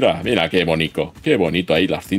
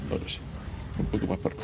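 whispered aside; 11 dB quieter, much faster than usual. Real, not acted. Quiet aside while building.

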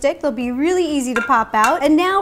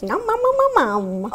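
playful munching noises; 3 semitones higher than usual. Pretend. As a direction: pretend to gobble it up. Playful imitation of eating.

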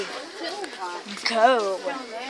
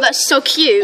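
ordinary talk

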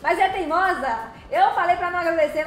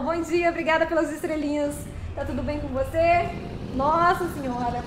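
usual speaking voice

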